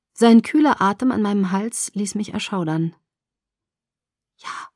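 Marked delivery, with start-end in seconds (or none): 4.4-4.7 a breathless whisper, much softer; whispered yes. A narrator's reading. She whispers yes to him, holding her voice steady. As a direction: whisper it so the voice won't break. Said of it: "Ich flüsterte".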